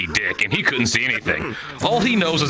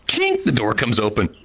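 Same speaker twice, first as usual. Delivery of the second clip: normal talking voice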